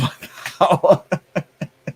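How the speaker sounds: bright burst of laughter; short laugh. Real, not acted. Laughs at own joke.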